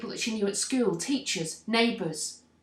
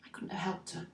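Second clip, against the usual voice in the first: soft, sunken admission of helplessness; sad resignation; 8 dB quieter than usual. Acted. Bystander accepting they couldn't help her, quietly resigned. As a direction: let it drop away quietly, defeated. Sinks into resignation after the protest.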